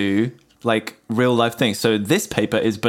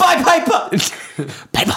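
loud ad slogan; shouting; 4 dB louder than usual. Acted. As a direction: yell it like a pitch. Mock ad shout.

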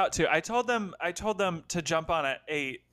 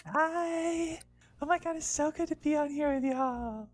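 put-on bright, higher girlish storybook voice; Little Red voice; 7 semitones higher than usual. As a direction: play a giddy fairy-tale girl. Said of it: "Little Red's joining".

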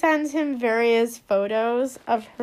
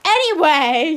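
loud, raised cut-in; exasperated segue; 4 semitones higher, 9 dB louder than usual. Real, not acted. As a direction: belt it out to drag the talk back. Cuts off the tangent.

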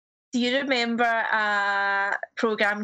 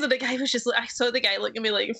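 usual speaking voice

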